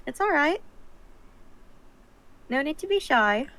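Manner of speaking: reassuring. Acted. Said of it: "It's like".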